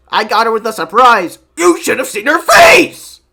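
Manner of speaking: high, loud mock-excited boast building to a shout; hammed-up bragging. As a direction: brag to your buddy, ending in a yell. Acted bragging to a friend.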